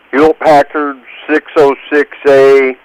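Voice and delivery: ordinary talk, nothing marked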